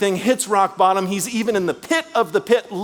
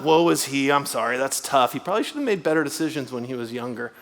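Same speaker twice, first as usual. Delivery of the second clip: dismissive pity; 8 semitones lower, 4 dB quieter, faster than usual. Retold or imitated. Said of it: "or something like that".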